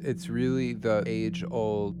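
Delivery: ordinary talk, nothing marked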